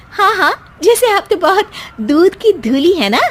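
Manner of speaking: high, mocking yeah yeah; wife mocking mother-in-law's innocence; sarcastic retort. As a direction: sneer back at her sarcastically.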